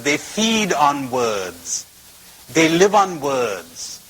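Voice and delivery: each word laid down slowly; slow right down to drive the point home; slow emphasis. Real, not acted. To the audience, slowly stressing how we live on words.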